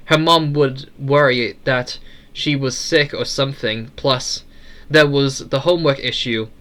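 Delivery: ordinary delivery, nothing marked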